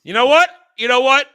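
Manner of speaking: loud, high, rising challenge; yelling. Real, not acted. Reactor about to side with Dom, yelling. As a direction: holler it as an irate build-up. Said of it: "I'm yelling at a kid".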